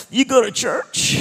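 high, loud, slow incredulous squeal; mock disbelief. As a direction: stretch out the shock, half laughing. Teller's own disbelief at invitation.

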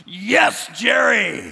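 loud triumphant shout. Acted. Preacher cheering the movie director, loud triumphant shout. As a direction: cheer at the top of your voice.

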